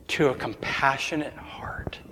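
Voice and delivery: sinking to a whisper on compassionate heart; preacher hushing to land the point; hushed contrast. Real, not acted.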